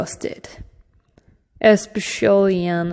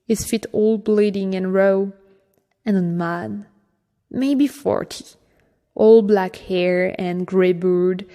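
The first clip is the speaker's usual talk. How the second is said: usual speaking voice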